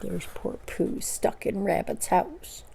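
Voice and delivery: whispered, slow pity; soft aside. A narrator's reading. Narrator telling listener about stuck Pooh, whispered. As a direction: confide it gently.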